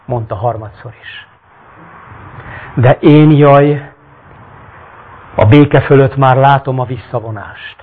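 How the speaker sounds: dramatic reading of a Bible-like tale; story narration. A narrator's reading. Reads the story aloud.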